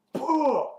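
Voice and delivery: high, loud burst that falls away; imitated rake blow. Andrea hits with the rake, loud imitated blow. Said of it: "She goes".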